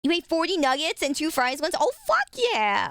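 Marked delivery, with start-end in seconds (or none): 1.8-2.9 excited whoop. Real, not acted. Streamer thrilled by a chatter's forty nuggets, excited whoop. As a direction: whoop it out in delight. Reacting to chat's nugget feat.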